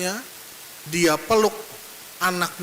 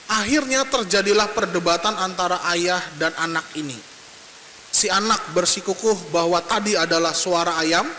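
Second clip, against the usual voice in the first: ordinary talk, nothing marked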